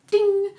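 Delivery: high, ringing, drawn-out "ding"; imitated ding. Shown as a demonstration. Vlogger describing a lightning twinge to viewers, high sustained ding. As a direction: sound a loud little bell ping.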